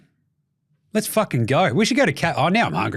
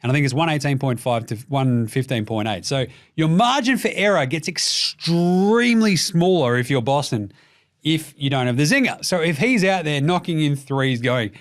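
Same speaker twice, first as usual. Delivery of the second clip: usual speaking voice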